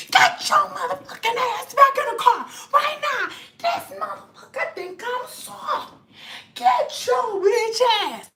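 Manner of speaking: high-pitched, hammed-up scolding; imitating a raging grandma; mock furious grandma. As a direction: order the kids back, slowing for emphasis. Grandma ordering the kids, retold.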